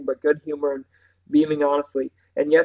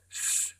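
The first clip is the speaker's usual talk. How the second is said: quiet, sharp shushing sound; hushing shh; 12 dB quieter than usual. Acted. Boy warns the others about the door, hushing. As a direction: shush them urgently under your breath. Warning against being overheard.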